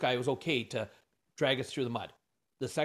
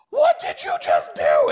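very high, loud, drawn-out mock shriek; aghast shout; 11 dB louder, slower than usual. Husband to wife after she raised the price, aghast shriek. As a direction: shriek it in stunned disbelief, stretching the words. Retold shocked reaction to wife's bold move.

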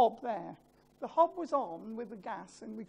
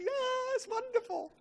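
delighted wife; 13 semitones higher than usual. Retold or imitated. Wife's delight voiced in retelling.

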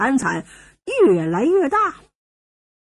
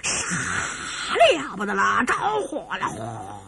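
hushed, drawn-out crowd shouting from afar; breathed faraway cries of alarm; stage-whispered yelling, trailing into a whoosh; distant uproar; 6 semitones lower, 6 dB quieter, slower than usual. A narrator's reading. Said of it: "大乱".

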